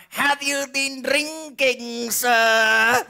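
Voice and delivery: high, drawn-out questioning of a driver; police officer. Acted. Police stop a driver at night, mock officer questioning.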